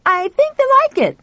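put-on very high, loud boy's call; high boyish shout; shouting back. A narrator's reading. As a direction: holler happily back as a boy. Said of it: "Pal shouted back".

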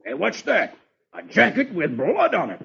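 lightly voiced puzzled sergeant; exclaiming. Sergeant finding a spattered jacket, mildly alarmed exclamation. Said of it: "He exclaims".

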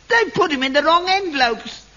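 usual speaking voice

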